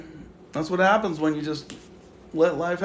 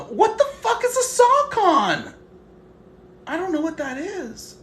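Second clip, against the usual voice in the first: baffled; 12 semitones higher, 5 dB louder, slower than usual. Real, not acted. Reading a baffling chat comment.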